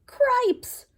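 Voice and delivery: high, sharp mouse cry; startled mouse. Acted. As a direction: yelp it in shock, high up. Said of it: "said Furlough".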